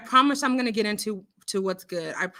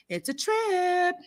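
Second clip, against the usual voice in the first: high, slow, stretched-out repeat; drawn-out amazement; 7 semitones higher, slower than usual. Retold or imitated. Host describing a show she watches, drawn-out amazement. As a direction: stretch TRIP out long and high.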